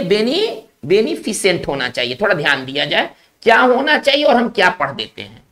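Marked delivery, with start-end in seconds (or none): 0.0-1.7 "बेनी-" first, then the whole "बेनिफिसेंट" ending fi-sent; correct pronunciation. Shown as a demonstration. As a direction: break off after "बेनी-", then say "बेनिफिसेंट" without an extra i. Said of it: "इसमें क्या होना चाहिए". ("बेनिफिसेंट" का सही उच्चारण, फि-सेंट)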